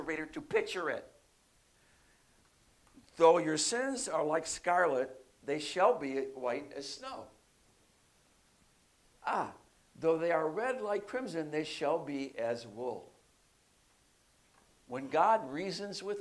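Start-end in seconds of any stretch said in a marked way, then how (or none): 3.2-13.1 measured, unhurried reading of Isaiah; slow scripture reading. Acted. Pastor reading scripture aloud to the congregation, slow and measured. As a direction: read the verse slowly, letting each line land.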